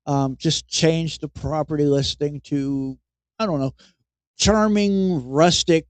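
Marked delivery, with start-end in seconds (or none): none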